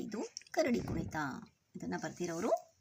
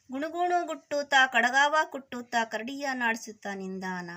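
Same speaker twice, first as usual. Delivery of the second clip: poem recitation; 4 semitones higher, 6 dB louder than usual. Acted. Teacher reads the bear-dance poem to children, sing-song recitation. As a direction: chant the verse, stretching each closing word. Recites verse before explaining it.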